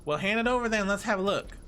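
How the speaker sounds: folksy country bumpkin officer; bumpkin accent. Acted. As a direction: play a curious bumpkin cop. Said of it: "it's a bumpkin accent".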